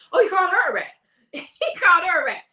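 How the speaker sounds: high, laughing disbelief; high giggly shock; amused surprise. Real, not acted. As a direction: squeal it out, tickled.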